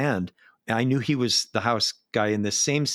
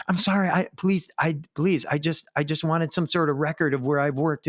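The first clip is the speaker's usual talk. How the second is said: higher, pleading yell; yelling into an ear; 5 semitones higher than usual. Retold or imitated. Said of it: "He yells it into my ear".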